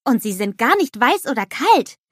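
high, loud eager snowman voice; bubbly cartoon snowman exclaiming; Olaf calling out. A narrator's reading.

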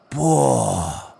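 drawn-out "boah" of wonder; awed. A narrator's reading. Leo spots the towering cake, long awed exclamation. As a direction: let the exclamation stretch out in amazement.

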